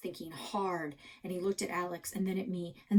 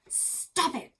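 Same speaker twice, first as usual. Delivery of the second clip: sharp raised "Stop it"; shout; 10 semitones higher, 5 dB louder than usual. Acted. Kid shouting at Alex to stop bragging. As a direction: snap at him to stop. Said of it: "I shouted".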